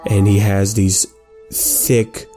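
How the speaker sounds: ordinary delivery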